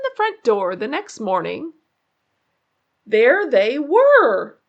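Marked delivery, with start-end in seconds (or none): 3.1-4.6 loud, slow ominous reveal; spooky story reveal; dramatic reveal. A narrator's reading. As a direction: land the scare slowly.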